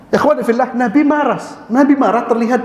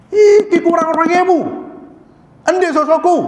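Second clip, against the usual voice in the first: higher, louder mock outburst over change; hammed-up scolding of a cashier, pitched up; angry customer; 10 semitones higher, 3 dB louder than usual. Customer scolding the cashier over two thousand rupiah, mock angry shout. Ngelabrak kasir.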